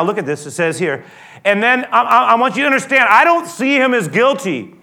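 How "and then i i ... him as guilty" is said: normal talking voice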